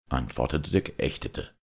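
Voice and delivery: ordinary delivery, nothing marked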